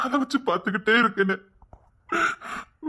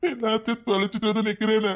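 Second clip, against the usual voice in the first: crying. Acted. Husband confessing he has lost everything, weeping. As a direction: wail the regret, building on the repeat. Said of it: "அழாதீங்கங்க".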